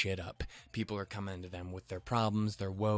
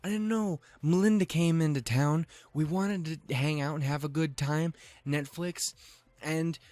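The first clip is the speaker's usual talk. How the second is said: high, rushed fake apology; high, hammed-up defensive rambling; mock defensive excuse; 5 semitones higher than usual. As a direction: plead your innocence in a raised voice. Defending himself in retold exchange.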